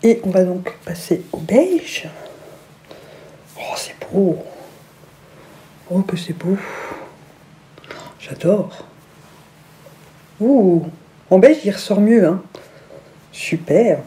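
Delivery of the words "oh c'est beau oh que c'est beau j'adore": breathy whispered admiration; awed whisper. Real, not acted. Vlogger trying on a headband, whispering admiration. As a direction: whisper it to yourself in awe.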